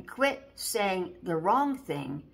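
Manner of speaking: Quit saying the wrong thing slowed right down; a slow, emphatic warning; slow, stressed rebuke. Shown as a demonstration. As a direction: lean on each word of "Quit saying the wrong thing" slowly.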